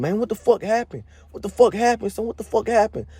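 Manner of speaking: high, frantic voice of the friends asking what happened; panicked questions. Retold or imitated. Friends running up in alarm, voiced high and frantic. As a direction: pitch way up like alarmed friends rushing in.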